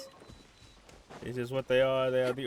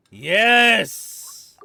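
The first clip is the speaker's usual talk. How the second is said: triumphant yell; 8 semitones higher, 11 dB louder than usual. Real, not acted. Cat reaches level fifty.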